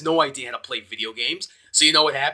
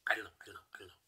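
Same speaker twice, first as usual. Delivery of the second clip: whispered; 6 semitones lower, 20 dB quieter, much faster than usual. Real, not acted. Whispered uncertainty.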